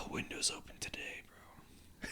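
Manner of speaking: breathy whispered aside to himself. Retold or imitated. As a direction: whisper it like a private thought. Inner thought voiced mid-story.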